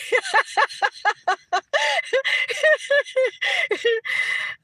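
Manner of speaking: long high-pitched giggling fit; real laughter. Real, not acted. Streamer cracking herself up over pitchforks, real laughter. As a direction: laugh on and on at your own joke.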